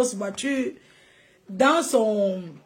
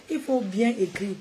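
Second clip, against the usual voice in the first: normal talking voice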